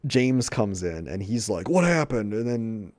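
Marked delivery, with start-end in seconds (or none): 1.7-2.3 higher, louder worried question; dad bursting in; mock alarmed. James rushing in after the slap, mock alarmed.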